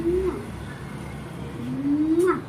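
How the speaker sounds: kiss sounds. Shown as a demonstration. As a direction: blow the pet a smacking kiss. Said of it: "এভাবে হামি দেবো". (চুমুর শব্দ)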